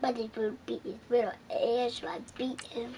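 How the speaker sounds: whispered under breath. Real, not acted. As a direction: hush it to a whisper. Child muttering during game.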